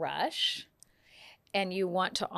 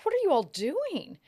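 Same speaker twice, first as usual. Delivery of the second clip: baffled question; 11 semitones higher, 4 dB louder than usual. Retold or imitated. Washington baffled by his copying guests.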